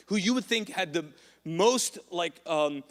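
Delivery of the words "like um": ordinary delivery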